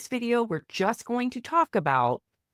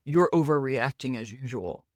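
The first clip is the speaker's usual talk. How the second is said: dismissive; 7 semitones lower than usual. Pretend. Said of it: "when faced with dismissiveness".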